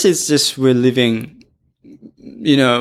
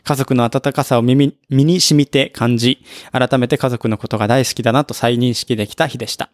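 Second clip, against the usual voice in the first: brisk read-aloud of a listener letter; much faster than usual. Acted. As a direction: read it out quickly like fan mail.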